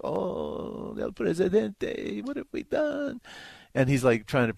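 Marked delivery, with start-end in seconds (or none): 0.0-3.2 high, wailing mock grief; mock mourners. Mourners weeping over the president's glass coffin, mock wail. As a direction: lament over the coffin, hammed up. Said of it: "everybody's like".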